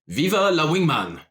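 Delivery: calling out. Acted. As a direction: hail the room with a toast. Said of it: "he calls out to his companions".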